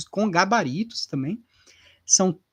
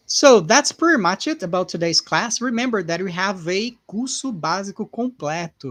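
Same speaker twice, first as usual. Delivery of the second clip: usual speaking voice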